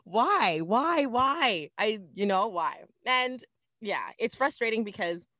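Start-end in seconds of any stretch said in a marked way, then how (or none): 0.0-1.7 loud, high repeated why; mock despair, louder each time; exasperated why. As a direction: throw up your hands, keep asking why. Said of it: "I was just like".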